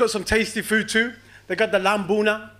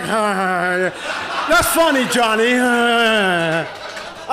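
guilty audience member's fake laugh; fake nervous laugh; slower than usual. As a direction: laugh like you're hiding something. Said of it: "Everyone's laughing normally".

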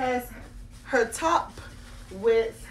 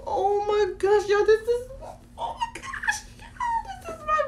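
ecstatic squeal; 13 semitones higher than usual. Real, not acted. First sees the baby doll.

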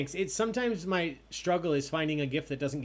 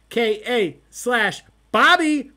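loud, high, drawn-out name; announcer call-out; 6 semitones higher, 12 dB louder, slower than usual. Real, not acted. Host reading standings to players, loud announcer call. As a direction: belt the team name out slowly.

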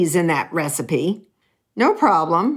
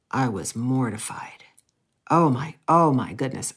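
whispered, low and breathless with shame; mortified whisper; 6 semitones lower, 3 dB quieter than usual. Real, not acted. Reliving grandfather's remark, a whisper of mortification. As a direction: whisper it like you want to vanish.

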